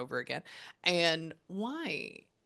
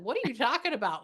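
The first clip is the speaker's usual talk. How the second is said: higher, louder retold challenge; mock disbelief; 6 semitones higher, 5 dB louder, faster than usual. Medium retelling her reply to a spirit, mock disbelief. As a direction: push back as if you can't believe it.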